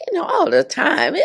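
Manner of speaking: tight, pinched, laughing through it; goiter-strained voice. Retold or imitated. Nurse showing her strained voice to the audience. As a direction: imitate the voice squeezed by a goiter. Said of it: "So my voice was like".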